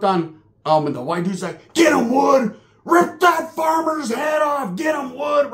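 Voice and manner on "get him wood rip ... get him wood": loud, high egging-on shout; hammed-up yard cheer; mock cheering shout.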